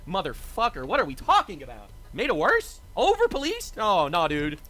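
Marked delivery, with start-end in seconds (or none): 2.1-3.8 high-pitched incredulous echo. Real, not acted. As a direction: throw the claim back, pitch leaping up in disbelief. Throws chat's claim back in disbelief.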